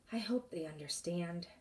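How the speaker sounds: soft unvoiced whisper; whispering to herself. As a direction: whisper it to yourself. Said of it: "Minley said to herself".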